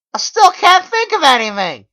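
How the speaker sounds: very high, loud shaky-free panic; shrill desperate admission; frantic. Acted. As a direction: blurt it out. Narrator after gun removed.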